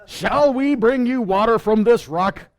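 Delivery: hammed-up rebuke of the rebels, dragged out; angry yell. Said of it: "Moshe in his anger, yelling out".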